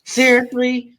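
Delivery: ordinary talk, nothing marked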